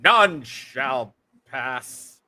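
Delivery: very slow, solemn knight's decree. Retold or imitated. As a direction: drag it out like a stubborn guard knight. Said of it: "Monty Python and the Holy Grail".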